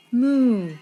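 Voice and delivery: loud, high mooing cow. A narrator's reading. Reading to a child, the cow's loud moo. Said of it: "And the cow said".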